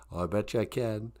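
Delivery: ordinary delivery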